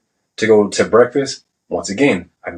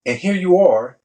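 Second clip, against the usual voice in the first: higher-pitched retold scolding from a superior; chewing out; 7 semitones higher than usual. Senior chief to sailor sent to his office, retold chewing out. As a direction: imitate a chief laying into a recruit. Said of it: "he started getting in my, in, on my shit".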